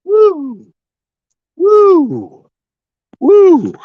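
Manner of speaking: loud high whoops; high woo cheer. Real, not acted. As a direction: whoop like a fan celebrating. Celebrating, high loud cries.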